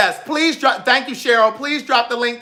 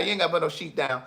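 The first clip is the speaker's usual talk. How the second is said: ordinary talk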